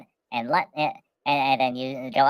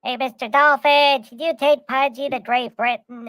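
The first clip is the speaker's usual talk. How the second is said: higher, louder, slow polite call; calling to the dolphin; 9 semitones higher, 4 dB louder than usual. Retold or imitated. Asking Mister Dolphin for a ride, high polite call. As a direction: hail the dolphin from the shore. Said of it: "you say to the nice dolphin".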